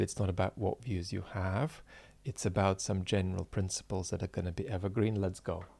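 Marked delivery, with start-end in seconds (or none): none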